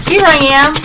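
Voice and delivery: high, put-on attention-seeking call; showy announcing. Acted announcing of oneself, attention-seeking.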